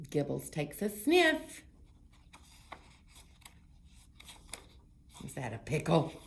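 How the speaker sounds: loud, lively picture-book read-aloud; reading to children. Acted. Librarian reading a story to children, lively. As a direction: read it big and bright for little ones.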